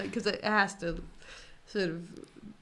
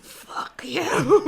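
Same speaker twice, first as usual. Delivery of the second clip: mock snarl; 8 semitones higher, 10 dB louder than usual. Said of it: "New Zealanders are like".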